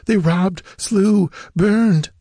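put-on very high girl's voice, halting between words; gasping, wounded girl. A narrator's reading. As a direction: struggle to get each phrase out, high-pitched. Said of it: "her voice swiftly growing weaker".

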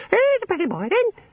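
very high squawky little bird; budgerigar voice. A narrator's reading. Little birds in the bush at night, squawking. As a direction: squawk it like a pet budgie. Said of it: "the bush was full of little birds".

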